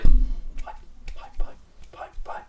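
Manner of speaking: breathy whispered taunt repeating five; hushed gloating whisper; mocking whisper. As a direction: whisper the score teasingly.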